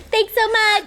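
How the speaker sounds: high, sugary fake thanks; fake cheerful thanks. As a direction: gush it falsely, way up high. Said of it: "You also have to say".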